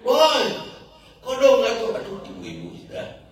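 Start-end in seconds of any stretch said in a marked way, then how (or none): 0.1-1.9 high, loud mock holler; sharp rise into a loud, high-pitched call; imitated shout. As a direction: mimic a woman yelling out of her car. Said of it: "Dia teriak".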